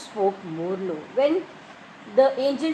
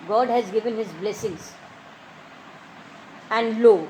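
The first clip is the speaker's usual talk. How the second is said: ordinary talk, nothing marked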